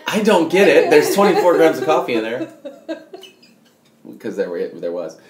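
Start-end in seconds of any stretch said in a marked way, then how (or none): 0.1-2.5 baffled indignation. Retold or imitated. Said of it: "and I'm like".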